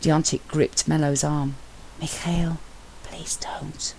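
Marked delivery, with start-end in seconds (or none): 2.0-3.9 voiceless, urgent whisper; a colleague softly begging him to stop; whispered plea. Acted. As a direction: plead under the breath.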